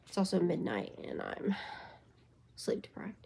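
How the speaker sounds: soft, whispered admission of tiredness; weary aside. Real, not acted. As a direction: trail into a tired whisper to yourself. Weary aside about being sleep deprived.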